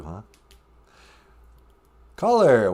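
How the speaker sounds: ordinary delivery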